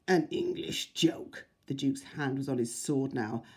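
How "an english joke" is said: put-on slow, sneering foreign accent; the Duke mocks the English in a foreign accent; Austrian accent sneer. A narrator's reading. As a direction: drag it out with scorn, foreign accent.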